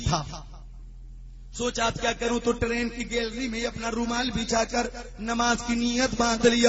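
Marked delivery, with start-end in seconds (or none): none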